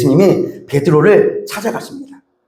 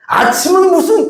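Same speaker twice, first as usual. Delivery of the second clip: much higher, sneering dismissal; scornful; 12 semitones higher than usual. Retold or imitated. Parent mocking the returning son, scornful. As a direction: scoff at him. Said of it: "그러잖아요".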